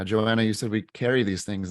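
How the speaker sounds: usual speaking voice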